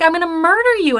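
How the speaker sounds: high, loud mock threat; retold threat. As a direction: sing out the threat in an exaggerated high voice.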